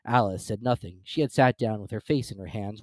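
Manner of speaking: plain reading voice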